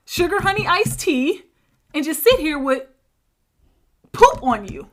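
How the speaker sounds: mimicked nagging. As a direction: imitate a fed-up complainer piling on questions. Mimics cousin's nagging complaints to son.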